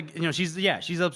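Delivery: normal talking voice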